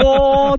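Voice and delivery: high, drawn-out bamboo flute "hoo"; imitated flute toot. Mocking life as a flute-playing monk, imitated flute. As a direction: hoot like a wandering monk's flute. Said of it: "笛吹いて歩かなあかんよ".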